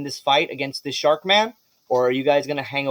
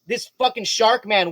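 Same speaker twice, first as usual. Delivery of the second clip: ordinary talk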